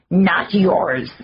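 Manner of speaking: a mild sharp retort; snapped. Acted. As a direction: snap back at her brother, firm. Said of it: "the Lushak snapped".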